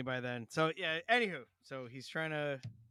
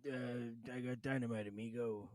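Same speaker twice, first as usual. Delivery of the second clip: lightly imitated shady river guide; lightly voiced Jon Voight; 4 semitones lower, 6 dB quieter than usual. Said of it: "Jon Voight's like".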